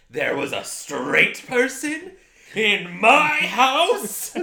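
high, slow, drawn-out gasp of disbelief; mock horror, stretching every word up high; shocked disbelief. Telling John about the guest, acting scandalised and shrill with shock. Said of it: "and I was like".

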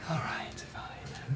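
whispered, giving in. Acted. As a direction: breathe it out, giving in. Clerk relenting under her breath.

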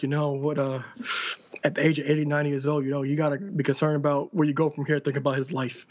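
low, grave mock-serious voice; most serious voice. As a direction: imitate someone being dead serious, pitched low. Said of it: "like, the most serious voice ever".

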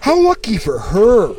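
high, drawn-out mock admiration; slow sing-song mockery; mocking gush. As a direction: parrot the compliment with exaggerated sweetness. Mimics customers praising the fiancé.

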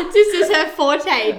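laughing through the words. Real, not acted. Teasing her friend about dating.

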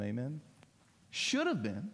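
usual speaking voice

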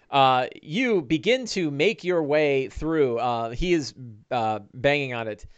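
ordinary talk

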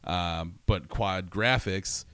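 usual speaking voice

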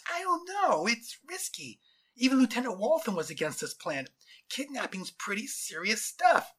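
high-pitched, worried underling voice; nervous soldier. Acted. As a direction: fret about the risk in a high voice.

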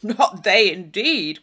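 loud, flat contradiction; Thorpe's loud denial. Acted. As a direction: shut her hope down. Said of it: "cried Thorpe".

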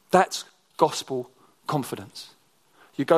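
normal talking voice

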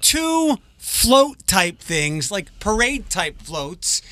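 high, very slow drawn-out voice. Retold or imitated. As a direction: stretch every word out slowly. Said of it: "That voice".

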